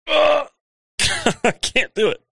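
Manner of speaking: laughing too hard to talk. Real, not acted. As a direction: breaks into giggles mid-line. Trying to talk through laughter.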